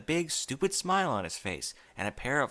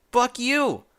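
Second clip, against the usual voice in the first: sudden high, loud yell; furious shout; 10 semitones higher, 9 dB louder than usual. Acted. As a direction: explode with anger at the poster. Enraged outburst before kicking the poster.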